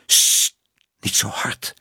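unvoiced urgent shushing; hushing whisper. A narrator's reading. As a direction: whisper it to hush her. Aunt hushes Minoes' loud question. (sissend gefluister)

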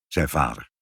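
usual speaking voice

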